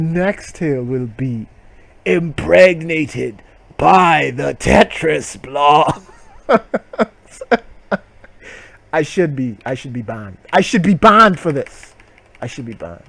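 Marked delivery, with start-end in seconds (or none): 2.0-6.1 slow, stretched title read; mock ominous title; dramatic title. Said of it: "title read dramatically". 10.5-11.9 mock guilt. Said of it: "mock self-scolding".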